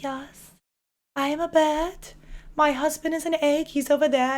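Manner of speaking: a lightly hammed German accent, playing the bird wife. Streamer imitating Heidi Klum in her German accent. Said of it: "like, her German accent".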